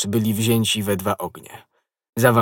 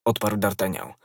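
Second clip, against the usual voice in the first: usual speaking voice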